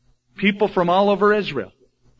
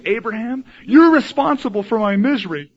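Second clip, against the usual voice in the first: mock accusing wife; 6 semitones higher, 4 dB louder than usual. Sarah blames Abraham.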